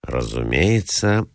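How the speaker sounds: usual speaking voice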